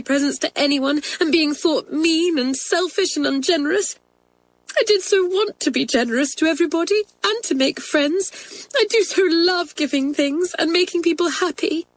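high, tearful outpouring; sobbing. Acted. Girl explains her stealing to twins, sobbing. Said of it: "pouring out her troubles between her sobs".

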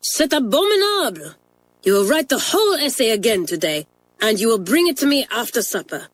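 put-on high, indignant French-accented scolding; Mamselle angry. French mistress scolding the twins over bad essays. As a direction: stretch C'est abominable out in outrage. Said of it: "cried Mamselle".

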